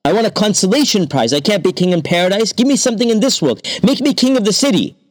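high, fast greedy pleading; whining demand. A narrator's reading. Thief bargaining with the king, high fast whine. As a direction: rush the demands.

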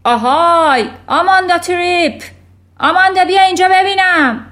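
loud, high bellow across the schoolyard; roaring. A narrator's reading. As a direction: roar the summons like a tyrant headmistress.

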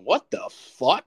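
slow, high, loud incredulity; drawn-out, incredulous exclamation; stunned disbelief. Retold or imitated. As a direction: draw it out in retold shock. Retelling shock at the roasting.

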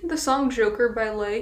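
ordinary talk

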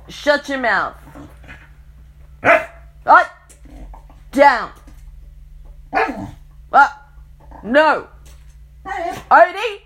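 yelling commands at the dog; shouted dog commands. Real, not acted.

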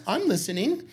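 high, excited mock voice; mock lazy friend. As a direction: perk up high at the food bit. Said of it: "You're like".

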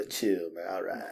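low, soft, easygoing; imitated laid-back guy. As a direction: reassure lazily in a low, quiet voice. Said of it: "He was laid back".

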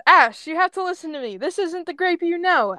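high, insistent plea; urgent pleading. Acted. Ember pleads with Ash.

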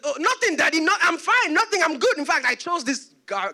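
high, hurried denials; nervous cover-up. Retold or imitated. Son hiding the truth from Daddy, high and nervous. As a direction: babble reassurances, acting the scared son.

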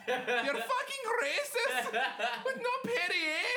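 very high, slow, half-crying with laughter; mock whiny customer. Outraged customer over Perrier, whiny and laughing. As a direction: whine it like a spoiled customer.

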